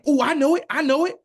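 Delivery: high, loud eager answer. Retold or imitated. Student calling out eagerly to the professor, high and loud. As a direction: call out like a keen kid in class.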